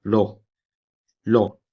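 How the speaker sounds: "लो" with the Japanese R coming out close to L; R leaning to L. Shown as a demonstration. Teacher showing how "ro" may come out, close to L. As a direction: say "लो" as a sound between R and L. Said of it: "एल और आर के बीच के sound होते हैं".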